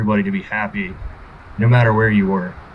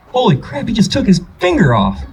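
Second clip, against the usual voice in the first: put-on amazed child; 10 semitones higher, 3 dB louder than usual.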